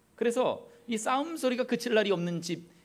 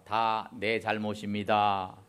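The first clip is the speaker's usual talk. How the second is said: slow, deliberate call to repeat; slow model line; 7 semitones lower, much slower than usual. Shown as a demonstration. Preacher leading congregation to repeat, slow deliberate phrasing. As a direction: lay out each word slowly for the congregation to echo. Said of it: "따라합시다".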